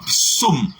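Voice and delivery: Psaume, opening P then som; P sounded. Shown as a demonstration. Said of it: "Il faut prendre le P en français".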